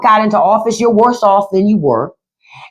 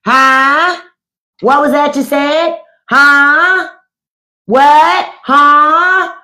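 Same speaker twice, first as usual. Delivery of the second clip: deaf grandpa; 6 semitones higher, much slower than usual. Retold or imitated. Mocking an old grandpa who can't hear you. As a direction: play a hard-of-hearing old man, a bit high. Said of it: "he's like".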